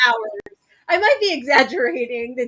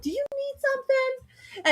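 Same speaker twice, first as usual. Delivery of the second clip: high concerned question; 12 semitones higher, 5 dB quieter than usual. Retold or imitated. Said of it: "I'm like".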